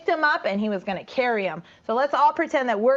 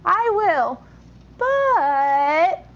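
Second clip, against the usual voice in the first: higher, louder little piglet; 6 semitones higher, 4 dB louder, much slower than usual. Acted. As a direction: squeak it out as a small piglet. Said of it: "sighed the piglet".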